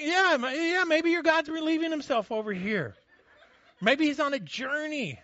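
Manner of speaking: high, loud mocking taunt with laughter; a gleeful sneering jeer. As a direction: taunt them, laughing at their silent god. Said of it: "Elijah mocked them".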